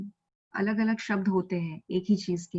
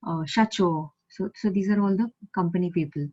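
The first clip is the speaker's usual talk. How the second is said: normal talking voice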